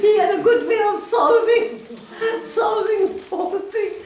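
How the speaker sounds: high-pitched words through laughter; laughing. Real, not acted. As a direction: still cracking up while summing up the tale. Laughing after the funny anecdote.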